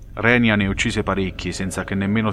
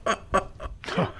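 breathy hearty laugh; veteran's rare burst of laughter; loud laugh; 12 semitones higher, 7 dB quieter than usual. Acted. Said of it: "emise una sonora risata".

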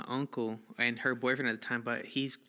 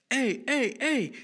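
car owner's sharp, high yell; loud, high-pitched shout after someone; calling out; 12 semitones higher, 5 dB louder, slower than usual. Retold or imitated. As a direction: yell to stop them, voice jumping up. Car owner yelling at the kids.

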